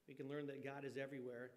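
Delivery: usual speaking voice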